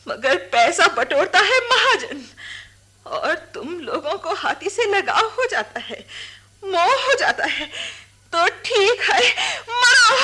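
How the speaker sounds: bitter outburst. Acted. Daughter to the mahouts, bitter, rising to a tearful shout.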